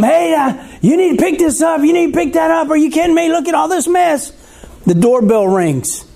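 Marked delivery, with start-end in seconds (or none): 0.0-4.3 scolding mom. Retold or imitated. Mom scolding kids at mess.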